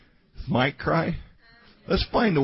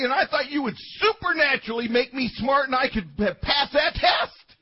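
angry prayer to God; 11 semitones higher than usual. Retold or imitated. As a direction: rant at God, getting more worked up. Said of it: "And I was mad at God".

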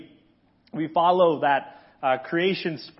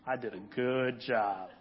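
chuckling God; 6 semitones lower, 10 dB quieter than usual. Pretend. God pleased with his work.

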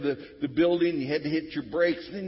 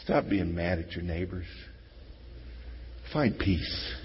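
ordinary delivery

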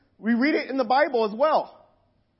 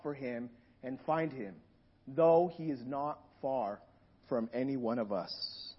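lower, softer, measured scripture reading; 6 semitones lower, 5 dB quieter than usual. Acted. Preacher reading Acts to the congregation, quietly reverent. Reads Acts seventeen aloud.

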